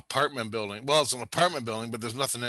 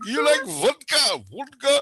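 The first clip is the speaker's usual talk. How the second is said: put-on high-pitched jokey Russian accent; mock Russian broken English; 11 semitones higher, 4 dB louder than usual. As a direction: ask in goofy pidgin Russian English. Goofing in broken English to foreigner.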